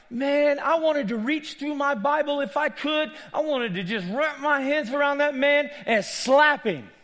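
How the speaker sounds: high, loud, worked-up rant; retold anger. Preacher retelling his fury at the ruler, angry shouting. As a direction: rage as if reliving it. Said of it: "I was literally getting angry".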